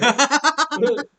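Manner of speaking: loud high cackle; high eerie laugh. Acted. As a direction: laugh like an unhinged stranger. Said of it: "he's like".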